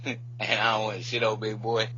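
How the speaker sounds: ordinary delivery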